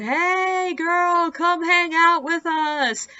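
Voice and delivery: put-on high, drawn-out drunken catcall; imitated shout. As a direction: call out across the road, mock-rowdy. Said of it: "shouted out something like".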